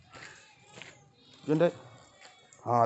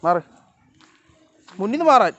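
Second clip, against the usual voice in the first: high, loud yell at the cat to move; shooing shout; 7 semitones higher, 10 dB louder than usual. Real, not acted. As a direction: bark it out so the cat gets out of the way. (ഉയർന്ന ശബ്ദത്തിൽ ഉറക്കെ ആട്ടൽ)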